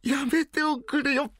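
high, slow, embarrassed protest; protesting cry. Acted. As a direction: wail it in shy dismay. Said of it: "やっぱり笑うじゃないか".

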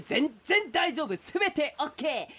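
a turtle brushing off every objection, cheerful; pushy turtle. Acted. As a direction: reassure too hard, all smiles. Turtle insists pushily.

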